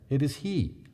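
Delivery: ordinary delivery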